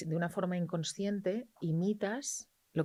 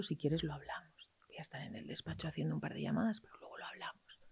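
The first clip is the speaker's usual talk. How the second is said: soft whispered reassurance; calm whisper; 9 dB quieter than usual. Shown as a demonstration. Parent calming an angry teenager in a whisper. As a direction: whisper calmly to an angry teen. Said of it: "Cariño, entiendo que estés enfadado".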